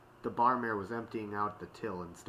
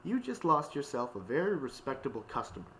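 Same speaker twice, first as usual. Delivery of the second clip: higher-pitched indignant retort; stiffly dignified, pitched up; huffy parting shot; 6 semitones higher than usual. A narrator's reading. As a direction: deliver an offended customer's last word. Retake of Octavia's parting shot.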